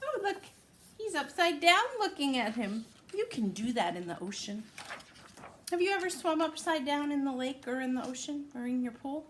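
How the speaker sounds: usual speaking voice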